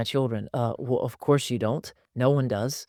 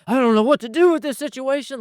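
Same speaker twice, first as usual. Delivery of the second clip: panicked parent; 9 semitones higher, 4 dB louder than usual. Retold or imitated. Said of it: "Don't be like".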